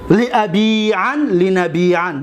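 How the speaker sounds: لیبیعن drawn out slowly; slow drill. Shown as a demonstration. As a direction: recite it slowly for the class. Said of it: "drills the verb form slowly". (آهسته و کشیده)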